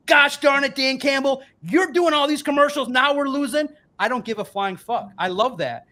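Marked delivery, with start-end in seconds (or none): 0.1-3.7 mock frustrated fan. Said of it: "Or if you wanna say".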